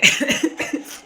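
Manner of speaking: breathy high laugh breaking out; short laugh. Real, not acted. Joking with the boss, a short real laugh. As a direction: laugh at the boss's reply. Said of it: "मुझे हंसते हुए भी खांसी आ रही है".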